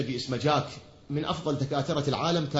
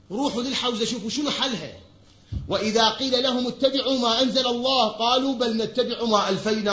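preaching; 5 semitones higher, 6 dB louder than usual. Acted. Recites verse, then preaches it.

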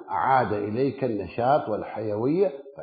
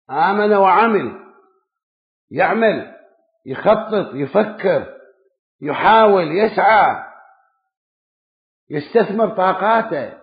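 raised, forceful pulpit delivery, word by word; preaching; 5 semitones higher, 4 dB louder than usual. Acted. Preacher urging the faithful to act, raised and forceful. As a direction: drive each duty home like a sermon.

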